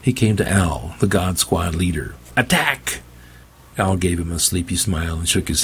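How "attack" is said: commanding. Acted. Preacher orders leader.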